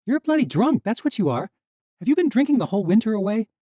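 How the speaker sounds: high, loud furious accusation; angry. Acted. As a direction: tear into him, fast at the end. Misha furious at Alec.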